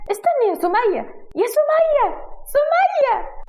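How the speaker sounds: very high, loud calls after the snake; calling out. Acted. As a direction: call the pet's name as it slithers off. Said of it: "وبتنادي عليها".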